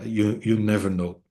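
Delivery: ordinary talk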